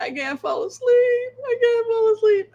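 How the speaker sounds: high, whimpering whine; mock whimper. To her cat at night, a mock crying whimper. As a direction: whine it like a sleepless kid begging. Telling cat she can't sleep.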